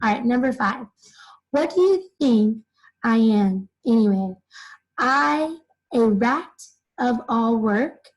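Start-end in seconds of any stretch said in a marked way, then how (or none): none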